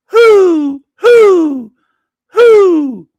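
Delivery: very high, loud owl hooting. Acted. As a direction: hoot each word like an owl. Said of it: "That is Owl Jesus".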